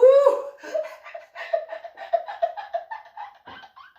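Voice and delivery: high shrieking laugh fit; helpless laughter. Real, not acted. Cracking up over "domly", helpless high laugh. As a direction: lose it completely laughing.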